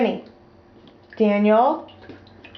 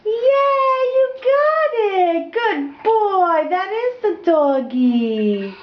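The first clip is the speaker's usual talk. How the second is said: excited praise; 9 semitones higher, slower than usual. Real, not acted. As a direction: celebrate his answer, delighted. Praising toddler's correct answer.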